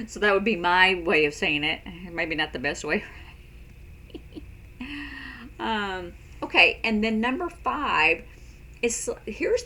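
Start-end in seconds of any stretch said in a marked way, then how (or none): 3.1-5.5 soft laugh. Real, not acted. Laughs, maybe not best way.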